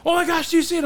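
high, loud mock panic; high-pitched, excited retold outburst; imitated freak-out. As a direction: act out a friend freaking out, pitch shooting way up. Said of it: "J B's freaking out, going".

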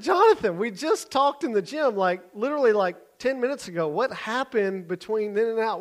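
high, loud, exasperated disbelief; incredulous protest. Retold or imitated. Leader to Jonathan ten minutes after their talk, loudly incredulous. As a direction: demand an explanation, hammed-up and high. Alarmed confrontation of the boy.

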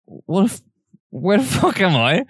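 high-pitched, bewildered mock panic; retold panic. Telling friend how he came round in bed, mock panicked. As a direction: re-enact waking up lost, voice leaping high. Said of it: "I'm visibly panicking".

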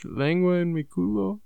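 high, slow put-on Spanish voice; sultry mock Spanish; Spanish accent.

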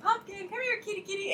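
high, sing-song coaxing call; calling the cat. Retold or imitated. Owner calling her cat back from the hallway, high coaxing call. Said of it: "I start calling her".

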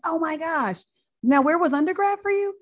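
attending eagerly quizzing the intern; excited. Retold or imitated. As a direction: lean in, thrilled to ask. Attending eagerly asks about undergrad.

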